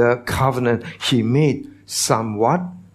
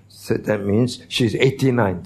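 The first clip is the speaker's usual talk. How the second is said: ordinary talk, nothing marked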